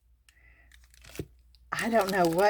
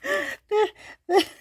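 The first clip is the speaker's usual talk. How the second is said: a higher giggle trailing off. Real, not acted. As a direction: keep laughing as it fades. Still laughing at the glasses.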